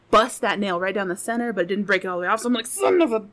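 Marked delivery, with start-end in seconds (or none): 2.7-3.3 pissed off. Retold or imitated. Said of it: "like, pissed off".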